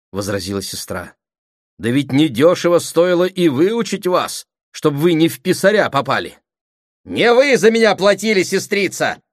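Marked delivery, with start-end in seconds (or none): 1.8-9.2 loud, high bickering; heated retort. Acted. Brother and sister quarrelling over schooling costs, heated retort. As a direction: fire back indignantly.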